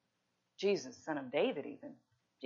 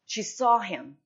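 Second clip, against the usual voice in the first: she saw him punched out loud; first take of she saw him, called out loud; emphatic, louder take; 3 semitones higher, 9 dB louder, slower than usual. Acted. Repeated line, first take punched.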